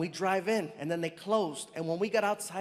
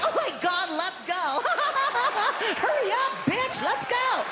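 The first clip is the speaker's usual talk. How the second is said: put-on very high, loud girly squeal; giddy hot chick; 5 dB louder than usual. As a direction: shriek with laughter like an excited girl. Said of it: "I felt like a hot chick".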